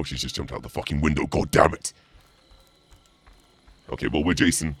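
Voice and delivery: ordinary delivery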